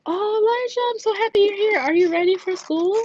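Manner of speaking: very high, gushing office-lady voice; high-pitched, over-friendly welcome; gushing greeter. Retold or imitated. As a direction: imitate a lady sweetly greeting a child.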